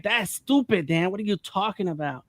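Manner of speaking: high-pitched mock disbelief, incredulous; dismissive response. People reacting to his comic book dream, mock dismissive. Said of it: "like that kind of response".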